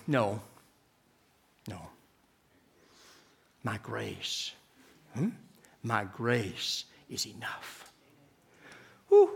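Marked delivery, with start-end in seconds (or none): 0.1-7.8 God's gentle answer. Retold or imitated. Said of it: "And three times God said".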